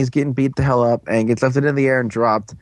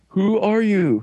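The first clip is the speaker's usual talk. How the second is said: retold challenge; 7 semitones higher, slower than usual. Retold blunt question.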